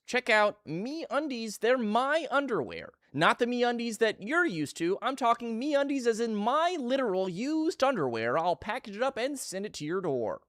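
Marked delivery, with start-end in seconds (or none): 0.1-8.4 ad read. Pretend. Mock sponsor ad read.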